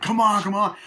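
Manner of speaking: high, loud hurried call; mock urging. Reviewer voices Winston urging the family to run, high and loud. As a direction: hurry them along, calling out.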